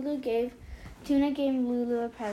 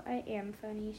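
softer, private self-talk; quiet aside to oneself; said to herself; 6 dB quieter than usual. A narrator's reading. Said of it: "she said to herself".

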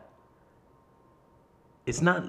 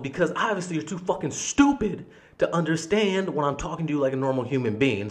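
higher-pitched, exasperated outburst; retold angry yelling; 5 semitones higher than usual. Customer yelling back at manager, retold in anger. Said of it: "now I'm starting to just yell at this guy".

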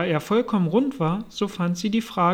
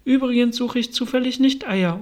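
higher-pitched, indignant correction; pointed retort; 6 semitones higher, 3 dB louder than usual. A narrator's reading. Alice denies hunting the pigeon's eggs, pointed. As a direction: correct the accuser with raised pitch.

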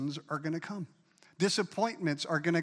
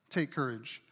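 ordinary talk

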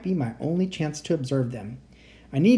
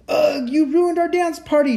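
high, loud furious monster shout; 13 semitones higher, 6 dB louder than usual. Acted. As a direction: yell it, dance party ruined. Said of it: "shouted the monster".

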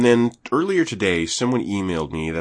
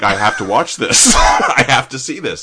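laughing; 2 semitones higher, 8 dB louder than usual. Retold or imitated. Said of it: "And I'm like".

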